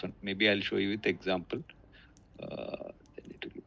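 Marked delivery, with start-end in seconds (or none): 2.4-3.6 faint, cutting out on the call; barely audible. Real, not acted. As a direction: voice dropping away to almost nothing. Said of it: "Your voice is very low".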